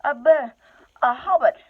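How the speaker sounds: high, loud, stammering answer; a captured hobbit blurting out his name; loud, high, stumbling reply in fear; frightened hobbit. Acted. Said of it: "said poor Bilbo".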